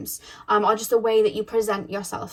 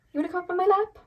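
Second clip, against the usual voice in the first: higher-pitched pet-talk question; 9 semitones higher, much faster than usual. Real, not acted. Reader to clingy Vader wanting her lap, high pet-talk.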